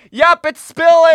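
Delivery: very high, slow, loud mock report; mock deadpan kids. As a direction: squeak it out like a useless kid. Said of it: "we look and go".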